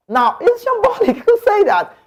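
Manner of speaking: a high, scoffing laugh through the words; incredulous laugh. Real, not acted. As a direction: laugh it off as absurd. Mocking a pundit's claim.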